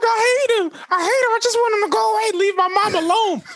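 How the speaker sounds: put-on very high whiny kid's wail; mock crying. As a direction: wail it like a bawling little kid. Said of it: "the kid starts crying".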